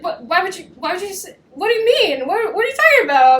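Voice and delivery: high, stammering protest; mock flustered denial. Her dodging his question about love, mock flustered.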